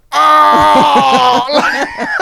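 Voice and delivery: high loud imitated yell; mock yell. Mate imitates the friend's cry diving into bushes, mock yell. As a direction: mimic the cry from the bush.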